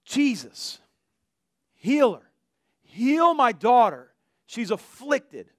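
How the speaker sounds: imitated crying out. Woman begging Jesus for her daughter, imitated crying out. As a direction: call out the woman's plea, easing off at the end. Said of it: "a woman over here screaming out".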